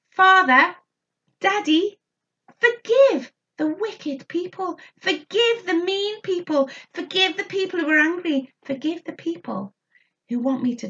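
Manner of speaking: high, earnest plea to a father; pleading prayer. Retold or imitated. Jesus praying to his father, lightly voiced plea. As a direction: beg forgiveness for others. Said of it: "Jesus uses his voice to say to his daddy".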